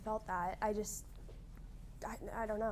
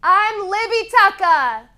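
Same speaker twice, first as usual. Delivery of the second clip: owning the shout; 3 semitones higher, 8 dB louder, slower than usual. Shown as a demonstration. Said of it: "Now own it".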